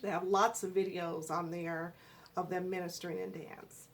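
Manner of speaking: ordinary talk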